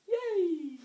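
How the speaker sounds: squeaky high yay; a bright excited little squeal. Real, not acted. Cheerful squeal on greeting.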